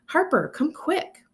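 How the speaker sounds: put-on high, slow child's call; young brother calling his sister; little boy calling. A narrator's reading. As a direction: call out like an excited four-year-old.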